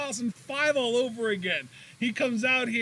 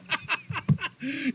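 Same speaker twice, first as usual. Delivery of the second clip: laughing fit; 7 semitones higher than usual. Real, not acted. Cracking up on air.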